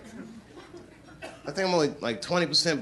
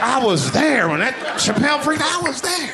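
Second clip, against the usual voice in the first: high, loud giddy fan voice, laughing; excited fan bragging; 7 semitones higher, 6 dB louder than usual. Retold or imitated. Fan bragging to friends, high and giddy. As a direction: brag like a thrilled audience member. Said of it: "He's like".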